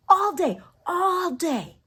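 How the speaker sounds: high, loud singled-out crow; playful boast. Acted. Boasting about her hummingbirds, playful high crow.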